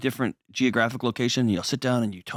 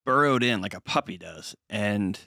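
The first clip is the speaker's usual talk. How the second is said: normal talking voice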